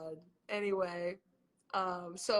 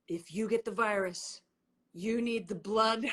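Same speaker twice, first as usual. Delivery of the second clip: spooky oracle voice; 4 dB louder than usual. Retold or imitated. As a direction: intone it like a dark prophecy. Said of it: "it's like".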